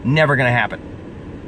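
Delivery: ordinary talk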